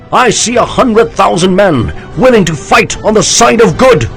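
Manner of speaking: high loud oratory, slowing at the end; rousing speech. A narrator's reading. General rallying his army, loud rousing oratory. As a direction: stir the troops with every word.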